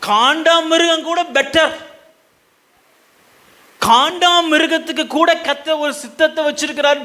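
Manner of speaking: high, ringing sermon delivery, each phrase driven home; full-force preaching. Real, not acted. Preacher quoting scripture on wayward Israel, full-force preaching. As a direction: proclaim it to the whole congregation.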